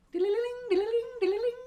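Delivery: high, sing-song chime ringing; chime imitation. Shown as a demonstration. Teacher imitates watch chimes, high sing-song. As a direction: ring it out like bright little chimes. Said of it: "You can hear them".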